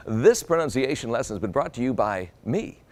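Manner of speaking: ordinary talk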